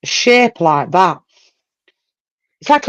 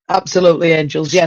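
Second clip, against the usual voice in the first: usual speaking voice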